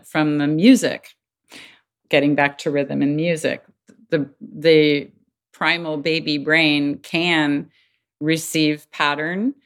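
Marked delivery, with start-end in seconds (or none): none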